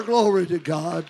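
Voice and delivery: ordinary talk, nothing marked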